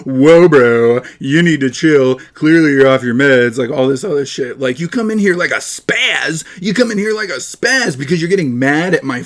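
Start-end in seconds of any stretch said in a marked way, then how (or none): 0.1-3.6 condescending mock calm. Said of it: "he goes".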